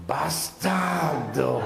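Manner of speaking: drawn out very slow and menacing, Bastardo; imitating his father's demonic Bastardo; Exorcist-style dad. Comedian imitating his dad cursing him, very slow. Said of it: "he'd say like that too, like he was in The Exorcist".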